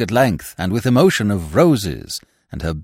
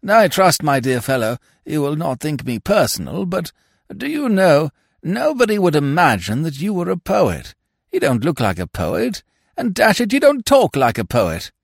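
higher-pitched, louder bewildered peer; dithering lordship puzzling it out; puzzled lord; 7 semitones higher, 4 dB louder than usual. A narrator's reading. Lordship questions his guest.

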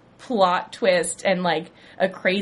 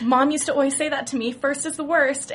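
higher, quick sing-song recital; quoting mom; 7 semitones higher than usual. Retold or imitated. Dee reciting what her mom always said, higher and quick. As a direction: rattle off mom's old saying. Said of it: "Dee's like".

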